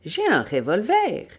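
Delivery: high-pitched indignant retort; indignant protest. Acted. Webster objects to being held back, indignant. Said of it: "Webster s'indigna".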